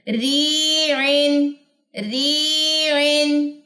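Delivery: ری and عین moving smoothly without strain; smooth madd. Shown as a demonstration. Teacher models smooth flowing long ee. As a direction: glide ری عین gently. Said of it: "smoothly move کریں".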